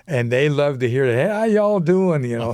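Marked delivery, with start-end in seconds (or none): 1.1-2.2 high, folksy Southern greeting; put-on Southern hello, pitched up; Southern drawl. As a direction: imitate a friendly American Southern accent. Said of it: "I love to hear the English accent".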